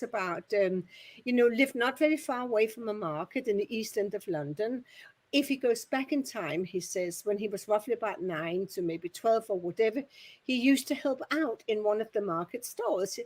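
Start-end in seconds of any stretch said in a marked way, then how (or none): none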